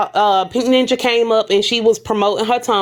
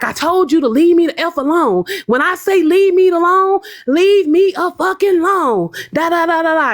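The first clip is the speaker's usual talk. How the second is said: retold yell; 7 semitones higher than usual. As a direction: imitate her yelling leave me alone. Retold yelling by Pink Ninja.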